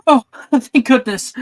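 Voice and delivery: high, loud burst of relief with a laugh; loud, high and relieved. Acted. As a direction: exclaim with laughing relief at finding a friend safe. Gemma finds her friend safe, hugs her.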